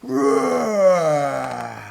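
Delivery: long, triumphant gorilla roar; loud victory growl, falling away. A narrator's reading. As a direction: roar proudly while beating your chest. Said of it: "he roared".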